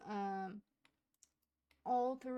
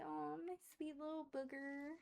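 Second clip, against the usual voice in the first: put-on higher, softer cooing baby talk; 6 semitones higher, 4 dB quieter than usual. Aunt cooing to her baby nephew with tender baby talk. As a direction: coo to the little one.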